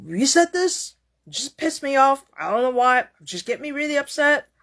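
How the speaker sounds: imitated angry complaint; re-enacted yelling. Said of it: "it seems like I was yelling at you".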